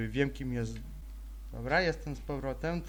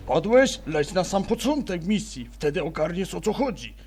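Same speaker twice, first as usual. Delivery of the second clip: mock game voice; 3 semitones higher, 7 dB louder than usual. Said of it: "że tak powiem".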